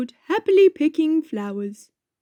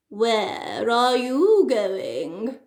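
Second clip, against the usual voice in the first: wolf voice; much slower than usual. Acted. Wolf asks, slow voice.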